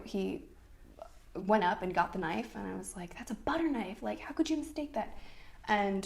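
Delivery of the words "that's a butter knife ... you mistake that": higher-pitched incredulous protest; retold exasperation. Wife argues with husband over butter knife, retold exasperated disbelief. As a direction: act out disbelief, pitch climbing.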